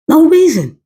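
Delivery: "No reason" pushed high and hard on both words; emphatic repeat. Real, not acted.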